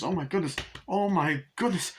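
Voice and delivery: high-pitched flustered cries; mock panic. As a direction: imitate someone panicking at a fire. Acting out panic at spreading fire.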